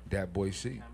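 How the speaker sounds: ordinary talk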